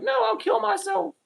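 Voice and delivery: very high whining refusal; whiny mock quote. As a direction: mimic a dramatic whiner refusing. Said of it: "They're like".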